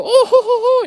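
very high hammed-up squealing; mock squeal. Mocking a YouTuber squealing over a catch, very high. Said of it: "and you go".

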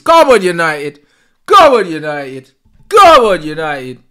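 loud cheering. Real, not acted. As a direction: roar the team on at kick-off. Cheering at kick-off.

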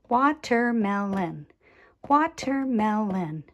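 "Watermelon" in four even beats; tapped syllables. Shown as a demonstration. Teacher modelling for children, "Watermelon" syllable by syllable. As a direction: say "Watermelon" as wa, ter, mel, on. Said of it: "tap out the different sound groups".

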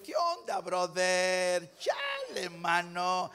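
exaggerated Mexico City sing-song with long vowels; chilango accent. Preacher mimicking a Mexico City local, chilango accent. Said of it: "Y si usted es chilango".

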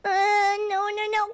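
normal talking voice

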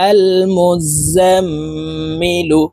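slow recitation, double m in মুজাম্মিলু held as a nasal hum; nasal ghunna. Shown as a demonstration. Teacher joining the syllables, long nasal ghunna. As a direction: draw out the ম্ম of মুজাম্মিলু through the nose. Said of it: "নাকের বাসি থেকে তিন second পরিমাণ গুন্না".

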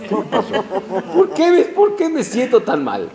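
laughing complaint. Retold or imitated. Laughing through a mock complaint.

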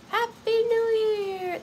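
high, slow festive cheer; New Year shout. Pretend. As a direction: call it out like midnight. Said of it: "so hard not to do every time".

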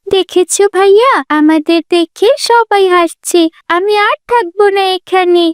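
put-on high, upset little girl's voice; hurt little sister. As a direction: complain to big brother, stung by laughter. (অপমানিত ছোট বোনের উঁচু গলা)

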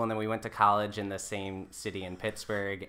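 ordinary talk

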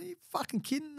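high-pitched incredulous outburst, half-acted; retold outrage. Telling off the lawyer in a high retold outrage. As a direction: throw the question up high in disbelief.

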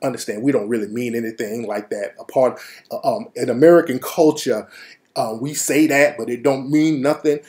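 low, soft, smoothing-over voice; quiet placating explanation. Retold or imitated. Q Dog soothing the angry Jamaican, low and soft. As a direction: talk him down gently.

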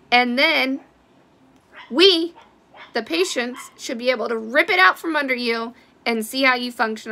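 loud, fired-up demand at doctors; real fury, pushing each point hard; angry rant. Real, not acted. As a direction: call out every doctor with real anger. Furious appeal to doctors.

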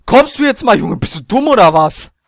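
high loud angry yell; yelling across the room. Real, not acted. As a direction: yell in exasperation. Yelling at cow.

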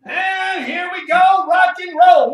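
slow, loud rally call; hyped coach shout. Real, not acted. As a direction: fire up the class like a coach. Coach kicks off push-up set.

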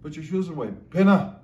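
"Pinna" punched loud and high; emphatic command. Shown as a demonstration. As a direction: tack on "Pinna" as a forceful clincher. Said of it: "Pinna gives ec-, emphasis to the command".

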